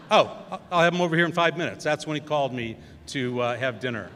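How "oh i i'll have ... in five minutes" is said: louder, fast and eager; eager offer. Retold or imitated. Chris telling the producer he'll bring his brother, fast and eager. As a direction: rush it out, thrilled with the idea.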